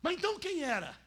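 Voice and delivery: high, loud incredulous question; a startled churchgoer asking back; surprised listener. Pretend. Voicing a doubting listener. (pergunta espantada, aguda e alta)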